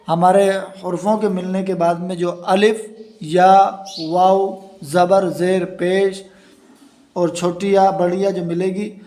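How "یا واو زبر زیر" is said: normal talking voice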